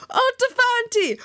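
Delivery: high-pitched exuberant exclamations; excited cry. A narrator's reading. Suitor thrilled she answers in Fanti, excited cry. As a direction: crow it in high delight. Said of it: "he cried".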